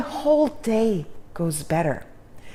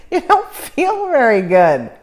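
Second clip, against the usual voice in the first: laughing; 4 semitones higher, 7 dB louder than usual. Real, not acted.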